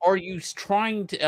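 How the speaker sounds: high, flustered half-question; baffled demand. Retold or imitated. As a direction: sputter the question in disbelief. Confronting wife again.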